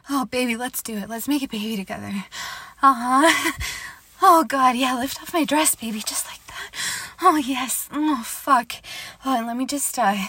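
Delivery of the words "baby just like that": soft whispered approval; whispered encouragement. Acted. To her partner as he undresses her, whispering.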